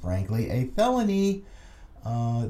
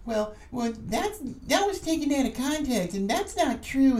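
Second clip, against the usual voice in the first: high whiny mock complainer; mocking critic voice; 10 semitones higher than usual. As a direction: mimic a whining critic, pitched up. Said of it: "I don't want anybody saying".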